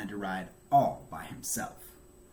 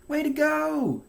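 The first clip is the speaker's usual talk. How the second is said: high, loud proud cheer; 5 dB louder than usual. A narrator's reading. Mother to Franklin riding alone, high loud cheer. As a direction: cheer your child on. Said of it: "cheered his mother".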